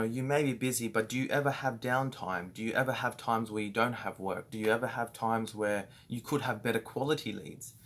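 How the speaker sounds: normal talking voice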